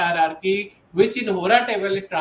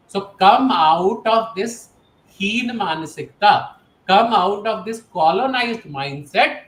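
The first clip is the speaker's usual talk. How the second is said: slow, louder, each word pressed; slow forceful appeal; 5 dB louder, slower than usual. Real, not acted. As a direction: drag them out of it, word by word. Urging listeners to drop mindset. (धीमी, ज़ोरदार appeal)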